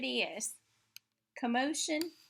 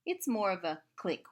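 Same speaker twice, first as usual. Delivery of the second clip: usual speaking voice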